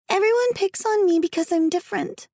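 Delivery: put-on high child voice, hurt; little girl Alice. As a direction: complain like a picked-on little girl.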